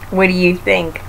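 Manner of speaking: usual speaking voice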